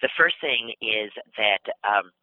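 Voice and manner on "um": ordinary delivery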